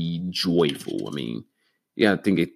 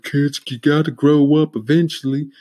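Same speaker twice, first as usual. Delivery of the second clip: mock grown-up; 8 semitones higher, 5 dB louder than usual. Mocking a preachy adult.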